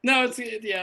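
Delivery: normal talking voice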